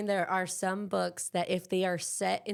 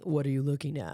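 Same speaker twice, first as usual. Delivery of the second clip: lower-pitched put-on man's voice; manly voice; 5 semitones lower than usual. Shown as a demonstration. Showing co-hosts a practiced male audiobook line, manly voice. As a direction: drop it down into a manly voice. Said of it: "doing manly voices".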